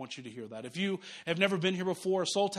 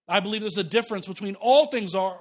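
usual speaking voice

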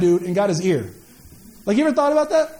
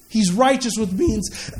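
normal talking voice

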